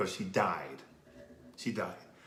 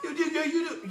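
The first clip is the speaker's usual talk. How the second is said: laughing insistence. Acted. Lecturing lady, stammering insistence.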